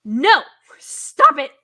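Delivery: loud, high scolding of a dumb character; No! as a loud outburst, then scold; mock yelling at the screen. Viewer shouting at a horror character, loud scolding.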